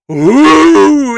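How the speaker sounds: high, loud, long "ooh"; swooping plunge sound effect; falling whoop. Retold or imitated. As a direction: wail as the ride drops away. Imitates the sudden drop.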